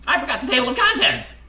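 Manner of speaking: high, very loud wail; panicked outburst. A narrator's reading. Narrator confessing a mistake mid-story, high panicked shout. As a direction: repeat it escalated into full panic. Jack repeats it, escalating.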